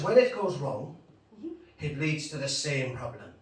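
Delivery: attempted Irish shopkeeper's accent; measured advice in a put-on Irish accent. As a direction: put on an Irish brogue, with weighty pauses. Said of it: "my attempt at an accent".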